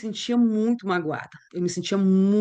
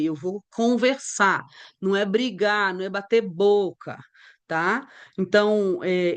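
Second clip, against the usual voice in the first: ordinary talk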